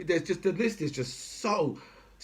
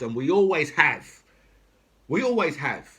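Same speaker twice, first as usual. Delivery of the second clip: emphatic insistence; 5 dB louder than usual. Real, not acted. Ranting about British TV sales.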